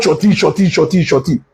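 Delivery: "shorty" in two syllables with an aw vowel, repeated; mocked mispronunciation. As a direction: repeat "shorty" as SHAW-tee, over and over. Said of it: "the real pronunciation will surety".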